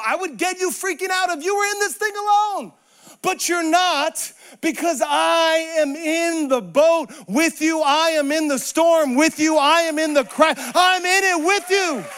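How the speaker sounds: impassioned Jesus. Acted. Jesus to those in the storm, impassioned reassurance. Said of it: "If Jesus were to answer that question, he would say".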